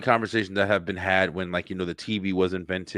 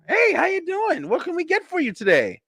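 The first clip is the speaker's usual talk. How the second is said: happy drive-thru worker. Retold or imitated. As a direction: overdo a too-happy drive-thru greeting. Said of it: "it's all happy-ass person on there".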